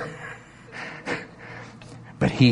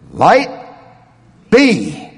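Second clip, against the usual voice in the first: loud, higher-pitched decree; commanding proclamation; 6 semitones higher, 5 dB louder than usual. Acted. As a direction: call it into being, word by word. Voicing God's creation command.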